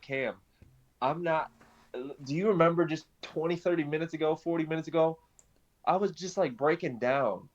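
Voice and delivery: high, bubbling excitement; retelling a joyful phone call to a friend, giddy and high; excited, overjoyed. Retold or imitated. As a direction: gush it out like you can't contain the joy. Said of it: "And I'm, like, jumping around".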